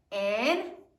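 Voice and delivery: "Answer" blended as an... ser; syllable by syllable. Shown as a demonstration. Teacher blends sounded-out answer, syllable by syllable. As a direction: stretch "Answer" into two separate beats. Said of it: "Sound it out".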